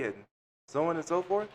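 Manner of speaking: normal talking voice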